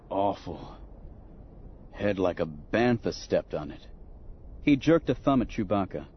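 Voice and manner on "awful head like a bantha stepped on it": groggy. Acted. Han groggy, hurting.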